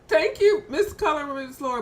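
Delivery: high, loud thank you; excited greeting. Real, not acted. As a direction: welcome her with a burst. Greeting arriving host excitedly.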